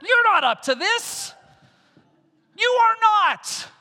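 high, loud, slow declaration; preaching shout. Acted. Preacher telling the congregation they can't, thundering. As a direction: drag it out and thunder it. Turn to your neighbor and say.

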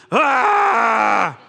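loud hammed-up roar; mock angry yell. Preacher acting out fretting over the wicked, loud mock angry yell. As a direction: let out a mock rage shout.

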